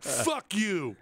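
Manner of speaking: drawn, hammed-up American insult; hostile American accent. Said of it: "nothing but hostility".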